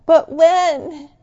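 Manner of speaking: high, loud pleading call; shouting back up. Retold or imitated. Children shouting up the mountain to their dad, pleading shout. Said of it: "they shout back up".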